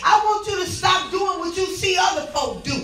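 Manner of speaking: shouting preacher building fervor; hollering revival preacher. Real, not acted.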